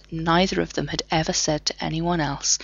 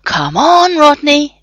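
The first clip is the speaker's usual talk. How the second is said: higher, louder push; urging; 10 semitones higher, 7 dB louder, slower than usual. A narrator's reading. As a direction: urge Rodney on with a higher, louder voice. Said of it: "John urged".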